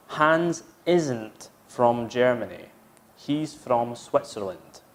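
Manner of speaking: slow clear model. Shown as a demonstration. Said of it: "Isn't is not".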